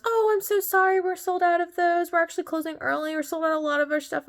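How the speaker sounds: high-pitched, quick imitated apology; apologetic taco seller. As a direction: play a super nice vendor letting a customer down.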